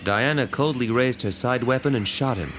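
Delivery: usual speaking voice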